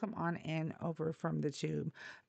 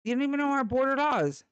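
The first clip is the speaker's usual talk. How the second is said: higher, louder exasperated shout; angry outburst; 7 semitones higher, 7 dB louder than usual. Real, not acted. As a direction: hit him with it, fed up. Angry rebuttal to Trump supporter.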